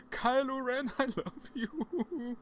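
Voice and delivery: high-pitched, slow, laughing squeal of a crazed fan; mock-crazed fan squealing at a star; maniac fan gush. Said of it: "running towards him like a maniac".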